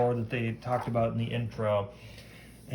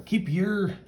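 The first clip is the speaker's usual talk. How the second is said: higher, slow attempt at a Scottish accent; 8 semitones higher, slower than usual. Woman warns off the hero, attempted Scottish accent. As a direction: put on your best amateur Scottish. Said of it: "Scottish. That's my best Scottish".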